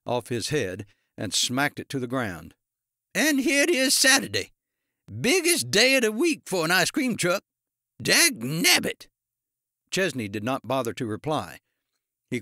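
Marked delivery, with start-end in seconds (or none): 3.1-9.1 put-on high, loud old ice cream man's outburst; furious rant. A narrator's reading. Ice cream man losing the week's biggest day, furious. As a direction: rage high and loud, slowing on the final curse. Owner smacks cap down, ranting.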